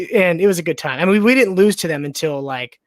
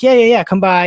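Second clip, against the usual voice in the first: eager roommate; 4 semitones higher, 8 dB louder than usual. Retold or imitated. Roommate voiced in story.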